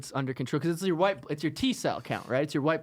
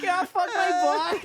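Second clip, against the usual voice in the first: high mock moaning; 3 dB louder than usual.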